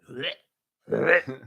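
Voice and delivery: imitated retching dog; short gagging noises, like bringing up a bone; mock hack from the throat. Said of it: "hacking up a bone".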